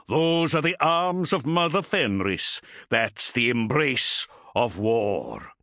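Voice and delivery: high, fervent battle sermon; gruff warrior voice. A narrator's reading. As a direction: preach war like a zealot. Bringar's proud war speech.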